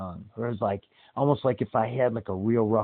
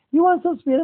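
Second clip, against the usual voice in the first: motherly voice; 7 dB louder, faster than usual. Retold or imitated. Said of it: "Mama Chino's like".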